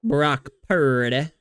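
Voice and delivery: slow Brock Purdy, long PUR; mocking echo of a stretched Purdy; Cam Newton. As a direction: draw out Purdy, ending on "deh". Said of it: "saying his name like that".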